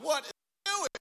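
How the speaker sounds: high-pitched exasperated squeal. Retold or imitated. Husband finding nothing at the summit, exasperated.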